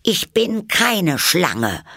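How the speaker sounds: high, loud, slow protest; indignant. A narrator's reading. Alice denies to the pigeon being a snake, indignant. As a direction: protest loudly in a high, affronted voice. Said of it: "sagte Alice entrüstet".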